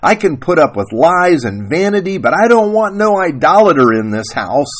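loud, high-pitched righteous tirade; screaming acted denunciation; screamed. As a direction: rail at him like an outraged wife. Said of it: "Sarah Ruth screamed".

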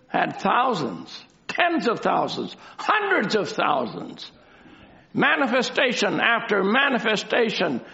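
full-voiced, declamatory sermon delivery; emphatic preaching. Real, not acted. Preacher to congregation about the crowds who left, emphatic preaching. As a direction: lean hard on each key phrase. Preacher recounting the falling away.

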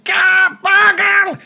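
very high, loud goblin yell. Acted. Fleeing goblin calls to its leader, yelling high. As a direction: cry out in a shrill panicked goblin voice. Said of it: "He's yelling as he runs".

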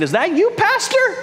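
high, startled "Is that you, Pastor?"; surprised call. Retold or imitated. Congregants passing their pulled-over pastor, surprised high call. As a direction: call it out like someone amazed to spot him.

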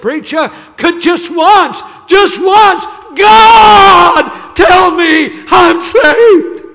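very loud, high, slow cry, voice straining; anguished shout. Retold or imitated. Weeping girl begs the preacher for assurance, shouting. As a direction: wail it out in despair. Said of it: "tears pouring down her face".

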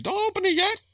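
squeaky high imitation of the wife; shrill falsetto, bossy warning; high mock wife voice. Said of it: "that she was going to".

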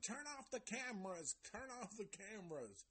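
high, soft imitation of yelling; mock distant yell. Reviewer imitating Mike yelling from his car, mock yell. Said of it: "he's still yelling".

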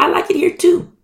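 bright agreement. Retold or imitated. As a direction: answer up high, delighted. Fellow servant voiced in parable.

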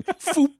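a single cartoon vaporizing zap; zap sound effect. Retelling people getting vaporized.

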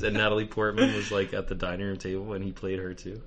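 ordinary talk

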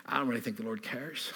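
put-on a light Dutch accent, quiet and dry; deadpan Dutch accent. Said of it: "stop with the Dutch accent".

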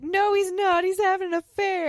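high, loud, tearful wail; crying outburst. Retold or imitated. Tired new mother blurting out her fear to friends, tearful and high. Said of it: "And she just starts crying".

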